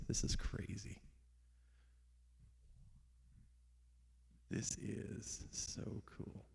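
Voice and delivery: hushed excited whisper. Real, not acted. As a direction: whisper it like a secret.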